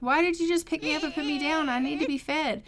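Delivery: lightly voiced put-out baby demanding food; indignant complaint.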